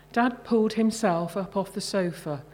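usual speaking voice

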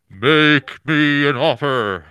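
loud, slow, booming divine voice; booming god voice. Retold or imitated. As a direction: boom it out like a god. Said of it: "he has a booming god voice".

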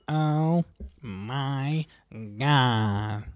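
slow, drawn-out Oh my God; Janice voice. Retold or imitated. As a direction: stretch it out like Janice from Friends. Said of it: "A los Janis".

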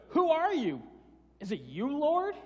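high, loud disbelieving cry of who are you; incredulous question. Retold or imitated. Saul, struck down, asks the voice who it is, high and loud. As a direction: Saul can hardly believe it's the Lord, loudly. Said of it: "It's like".

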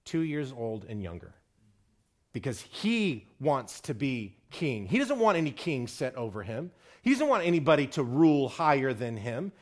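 ordinary delivery

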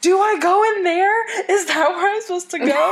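high, loud, laughing panic; flustered question. Retold or imitated. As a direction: ask in giggly panic. Asking zombie worker, laughing, confused.

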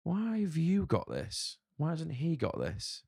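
high, slow, needling voice of self-doubt; inner doubt voice. Retold or imitated. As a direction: voice the nagging doubt, high and drawn out. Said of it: "doubt creeps into me and goes".

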